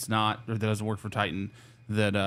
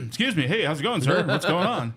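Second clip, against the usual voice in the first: higher, suddenly polite correction breaking into a laugh; flustered politeness; 8 semitones higher, 5 dB louder than usual. Retold or imitated. Officer realizing the owner is calling, flustered and polite. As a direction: scramble to sound respectful to the boss. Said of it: "And I go".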